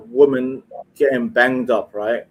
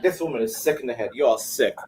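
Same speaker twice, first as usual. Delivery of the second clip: usual speaking voice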